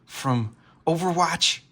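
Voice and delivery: loud, high starstruck gushing, easing at the end; excited fan. Retold or imitated. Fan first seeing Artemis in Fortnite, excited. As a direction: gush over a celebrity sighting. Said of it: "I got excited when I first saw Artemis".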